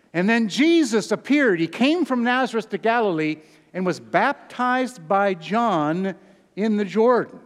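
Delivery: loud, high preacher's reading of the verse; preaching scripture aloud. Acted. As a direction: proclaim the text to the congregation. Reads scripture at full force.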